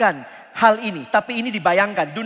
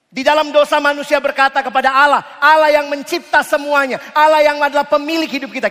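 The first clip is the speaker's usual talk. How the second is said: forceful preaching; 4 semitones higher, 5 dB louder than usual. Acted. Preacher pressing the congregation about sin, forceful. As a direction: preach it hard to the crowd.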